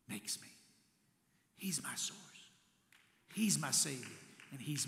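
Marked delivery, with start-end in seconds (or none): 0.1-2.2 whispered. Real, not acted. Intimate confession after laugh.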